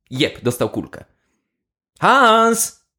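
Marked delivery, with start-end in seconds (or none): 2.0-2.8 calling out. Acted. Soldiers call out across trenches.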